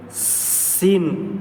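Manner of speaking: سین with a long held hiss; drawn-out sin demo. Shown as a demonstration. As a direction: stretch the s of سین for the student. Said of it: "سین کی آواز نکلتی ہے".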